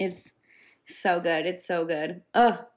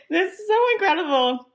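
much higher, excited praise; gushing; 11 semitones higher, 4 dB louder than usual. Real, not acted. Fan gushing to co-hosts about the episode, high excitement. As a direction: rave about it, voice soaring.

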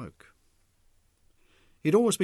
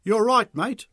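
loud, high call; called loudly; 10 semitones higher, 10 dB louder, slower than usual. A narrator's reading. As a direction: call out over the noise. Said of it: "Mick called loudly".

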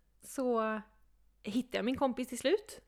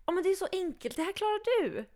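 higher, lightly voiced pushy host; 10 semitones higher than usual. Host talking her into the bar, higher voice. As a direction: coax her, light and high.

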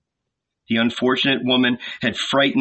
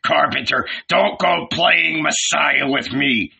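put-on old man's scornful, raised-voice rant; scathing telling-off; angry tirade; 5 semitones higher than usual. A narrator's reading. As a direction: berate him, pitched up and indignant. Furious old man berates carpenter's son.

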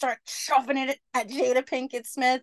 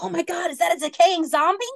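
higher, louder mock alarm; startled kid; 7 semitones higher, 5 dB louder than usual. Kid mistakes an old lady for a zombie, mock alarm. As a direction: gasp it out like you've seen a zombie.

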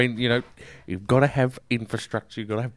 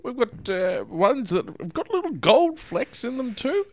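high put-on voice; 10 semitones higher than usual. Caller in put-on voice.

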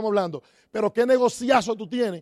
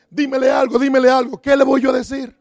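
higher and louder playful goading; egging him on to say something; teasing saleswoman; 4 semitones higher, 7 dB louder than usual. Retold or imitated. Saleswoman pressing the top distributor in front of everyone, loud and teasing. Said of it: "Y empieza".

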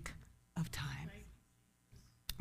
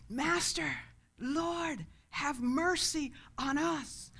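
raised pitch, drawn-out plea; crying out; 7 semitones higher, slower than usual. Retold or imitated. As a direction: call out for mercy at moderate volume. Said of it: "they cried out to Jesus".

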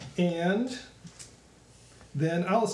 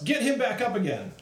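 higher-pitched, mild shout; 6 semitones higher than usual. Acted. Captain tossing a potion to revive an ally, shouting. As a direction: call out an urgent order, lightly shouted. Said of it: "and shouts".

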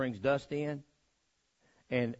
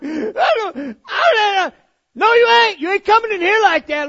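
very high, loud flustered shrieking; high frantic voice; 15 dB louder than usual. Retold or imitated. Muddy boy stammering at mama's door, frantic. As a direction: stammer it out, panicked. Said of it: "I'm like".

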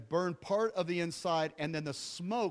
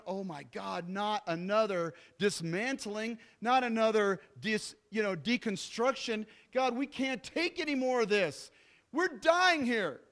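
mock despair; 4 semitones higher than usual. Parents hearing the fire news, hammed-up lament. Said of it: "And they're going".

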